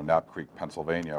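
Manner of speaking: normal talking voice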